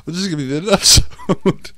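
laughing announcement. Real, not acted. Ending video episode.

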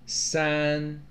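"三" held level and fairly high; high first tone. Shown as a demonstration. Teacher marking tones on numbers, high level tone. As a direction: sustain "三" on one even high pitch. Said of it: "first tone".

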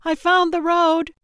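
high, loud call to the group. A narrator's reading. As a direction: shout the discovery across a distance. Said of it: "a woman called out".

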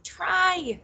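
high-pitched, urgent plea; pleading. A narrator's reading. Nettle urges Dee and Anna to try, pleading. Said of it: "pleaded Nettle".

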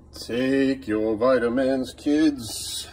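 slow, drawn-out ad announcer; hammed-up announcer reading a vitamin ad; extreme commercial voice. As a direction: stretch it out like a vitamin ad. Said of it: "the most extreme version of".

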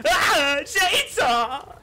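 high loud yell of Chainsaw!; screaming at a chainsaw in the game; shout. Real, not acted. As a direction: yell the warning in alarm. Yelling at the game.

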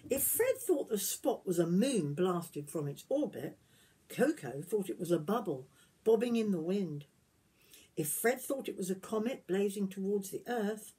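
gentle storybook reading voice; reading to children. Acted. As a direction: tell it like a bedtime story to little ones. Reading picture book aloud.

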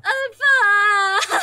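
loud wail of denial, in character; crying. Acted. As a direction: bawl it out, fooling nobody. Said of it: "you see her crying".

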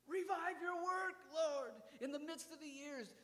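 high, loud urgent plea; urgent cry. Acted. Urgent quoted prayer after exclamation.

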